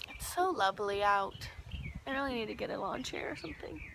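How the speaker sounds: soft breathy whisper to herself; whispered wish for a lawn chair; whispered aside. Real, not acted. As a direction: drop to a whisper, just musing. Quiet aside at end of vlog.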